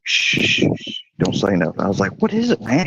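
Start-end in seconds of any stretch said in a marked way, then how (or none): 0.0-1.8 whispered shushing, no voice at all; urgent hush. Retold or imitated. Friend hushing him in hiding. 2.2-2.9 nervous question. Retold or imitated. Confused whisper-question while hiding.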